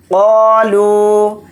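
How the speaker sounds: قالو with قا and لو each held long; slow even قالو; both syllables stretched. Shown as a demonstration. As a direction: draw out قا then لو evenly. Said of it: "قاف اور لام دونوں کو کھینچ کر پڑھیں گے".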